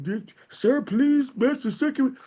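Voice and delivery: high, slow, pleading little-beggar voice; Oliver Twist beggar. Pretend. Mocking timid activists facing a Trump, in a high slow pleading beggar voice. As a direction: simper it like a timid miserly bum asking for scraps. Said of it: "like a little miserly bum".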